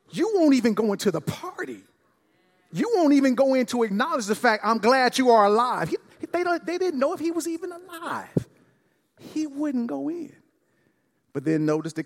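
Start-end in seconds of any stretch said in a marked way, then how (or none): 0.1-10.3 rising preaching fervor. Real, not acted. Preacher pleads about the elder son, climbing high. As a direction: pitch climbs high, voice straining with urgency.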